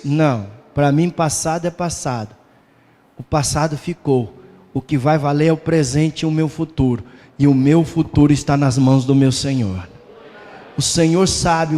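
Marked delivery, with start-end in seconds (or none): none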